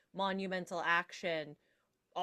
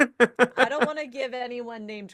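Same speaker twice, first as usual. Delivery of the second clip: laughing through the words, much louder; can barely get it out for laughing; 4 semitones higher, 14 dB louder than usual. Real, not acted. Joking about Snow's name.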